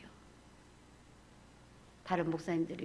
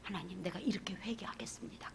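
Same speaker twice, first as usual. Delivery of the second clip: whispered tearful prayer; 9 dB quieter than usual. Retold or imitated. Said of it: "통곡으로 울었어요".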